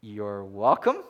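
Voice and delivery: lightly voiced God, low and slow; God's reply. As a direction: answer as God would, kindly and unhurried. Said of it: "I can picture God saying".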